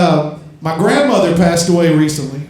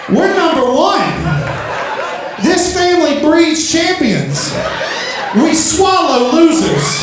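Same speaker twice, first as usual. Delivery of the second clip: proud mock outrage; 8 semitones higher, slower than usual. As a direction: boast it like a family chant. Proud retort to mom.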